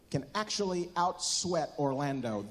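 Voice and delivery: ordinary talk, nothing marked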